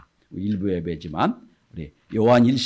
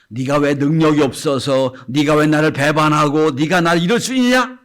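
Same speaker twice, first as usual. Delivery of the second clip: louder imagined scolding; mock rebuke; 4 semitones higher, 8 dB louder than usual. Pastor voices a rebuke Jesus did not give, raised voice. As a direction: voice the rebuke Jesus never gave. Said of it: "한마디 말씀이 없으세요".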